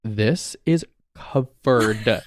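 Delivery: very slow, drawn-out disbelief; appalled discovery. Retold or imitated. Storyteller finds queso in the keyboard, slow mock horror. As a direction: stretch out each word in horror.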